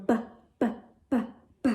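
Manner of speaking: short voiced b sound repeated; b, b, b as a clipped bouncy phonics sound; letter B sound. Shown as a demonstration. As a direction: phonics demo of b. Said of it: "makes the B sound by going".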